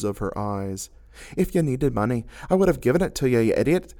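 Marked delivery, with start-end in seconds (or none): 1.4-3.9 spat. A narrator's reading. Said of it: "she spat".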